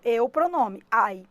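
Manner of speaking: usual speaking voice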